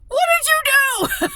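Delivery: high, loud shocked shout of disbelief; loud, high cry of astonishment; shocked yell. Retold or imitated. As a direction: yell it at him in total shock. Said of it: "I yelled it".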